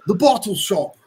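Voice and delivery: high, clipped-sounding British take on the phrase; accidental British accent. Acted. Friend repeats the phrase and slips into British. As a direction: say it like a Brit without meaning to. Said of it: "You literally sounded British".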